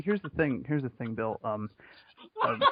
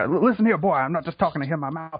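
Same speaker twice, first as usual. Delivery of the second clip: higher, louder Southern cartoon rooster; Foghorn Leghorn; 6 semitones higher, 4 dB louder than usual. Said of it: "the Foghorn Leghorn thing".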